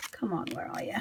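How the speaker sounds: hushed coaxing; soft whisper to a hidden card; whispered search. Real, not acted. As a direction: whisper while hunting for the card. Hunting for the card.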